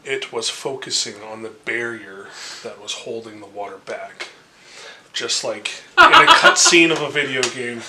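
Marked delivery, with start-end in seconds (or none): 5.1-7.8 loud, quickening storytelling with a laugh; excited narration. A narrator's reading. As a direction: build it up like a game cutscene. GM narrating dramatic flood.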